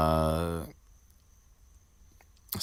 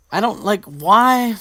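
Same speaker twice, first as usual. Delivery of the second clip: exasperated why; 10 dB louder, slower than usual. Real, not acted. Baffled at the author's choice.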